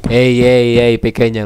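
loud, repeated calls to stop; loud protest. Real, not acted. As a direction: call out firmly to halt someone. Protesting to a game figure.

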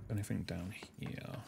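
hushed whisper. Real, not acted. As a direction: whisper it while sneaking. Sneaking through area.

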